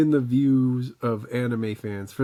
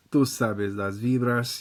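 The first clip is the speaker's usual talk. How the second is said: usual speaking voice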